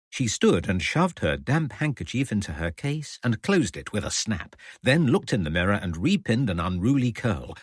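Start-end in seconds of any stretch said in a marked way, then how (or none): none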